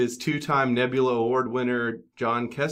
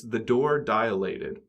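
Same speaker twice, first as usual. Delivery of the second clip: the door dilated, word by word, slowly; slow and deliberate; much slower than usual. Shown as a demonstration. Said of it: "a three-word phrase".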